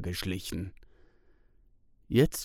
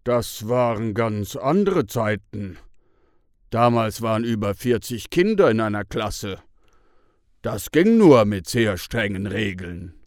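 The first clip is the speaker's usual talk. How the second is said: Bruno explains; 6 dB louder than usual. A narrator's reading. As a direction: explain it like a calm elder. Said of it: "Bruno erklärt". (Bruno erklärt tief und bestimmt die alten Zeiten)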